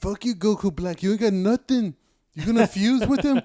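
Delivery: hammed-up challenge to a cartoon villain; taunting fighter. Fighter calling out a cartoon rival, mock taunt.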